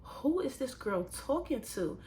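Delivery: high, put-on disbelief; retelling a coworker's command, then incredulous; incredulous inner voice. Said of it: "I'm like".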